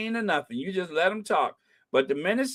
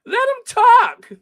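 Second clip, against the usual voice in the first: exasperated shout; 7 dB louder than usual. Retold or imitated. Viewer yelling at Lorraine to let the guest talk. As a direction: shriek it at the screen. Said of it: "I'm like".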